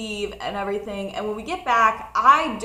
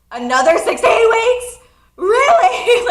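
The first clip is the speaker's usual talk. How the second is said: much higher and louder, laughing exasperation; outraged disbelief; 11 semitones higher, 10 dB louder than usual. Retold or imitated. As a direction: shriek the disbelief. Told six more weeks.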